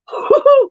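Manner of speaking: short shrill burst of laughter; a sudden squeaky laugh that escapes; sudden high laugh. Real, not acted. Laughs at own rant.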